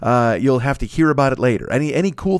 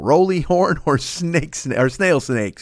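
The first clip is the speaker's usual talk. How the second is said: tongue-tied fumble; 4 semitones higher, slower than usual. Real, not acted. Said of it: "I knew I was gonna fuck that up".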